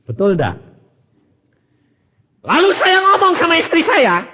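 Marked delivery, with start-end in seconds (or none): 2.4-4.2 1 loud preaching. Real, not acted. Preacher shouting the story opener.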